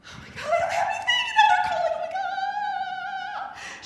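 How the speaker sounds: high, fast, frantic panic. Retold or imitated. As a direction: gush it out in a frightened rush. Retold panic at the call.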